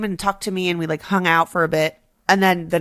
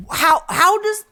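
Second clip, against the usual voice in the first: incredulous question; 9 semitones higher, slower than usual. Retold or imitated. Retelling disbelief at cousin.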